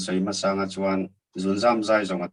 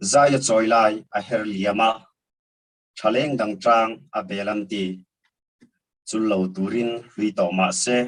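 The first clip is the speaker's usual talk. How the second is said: measured verse, a pause after every line; poetry recitation; 3 semitones higher, 3 dB louder than usual. Acted. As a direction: recite line by line, lingering on the phrase endings. Recites second poem.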